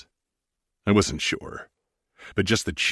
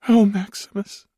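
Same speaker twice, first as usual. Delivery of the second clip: higher woman's voice, a touch shaky; 6 dB louder than usual. Acted. Sarah, moved, answers Maximus in a high, slightly shaky voice. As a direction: let it tremble a little, high and moved. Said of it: "Sarah said in a shaky voice".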